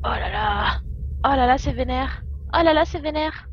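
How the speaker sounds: stunned exclamation. Real, not acted. Streamer reacting to his spell felling a tree, rising alarm.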